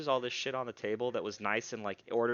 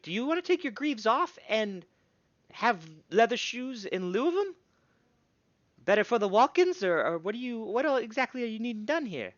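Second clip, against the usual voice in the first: higher, brisk craftswoman; gnome smith voice; 10 semitones higher, 4 dB louder than usual. Acted. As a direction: ask like a busy smith. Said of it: "she's like".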